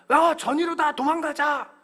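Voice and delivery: put-on high, loud child's call to run; kids' shout. Kids calling out to flee from the dad. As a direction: yell it like a cheeky kid.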